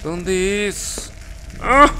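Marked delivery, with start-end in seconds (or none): 1.6-1.9 short, high, loud yelp; real startled "ah"; startled cry. Real, not acted. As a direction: cry out at a sudden scare. Jump scare while searching.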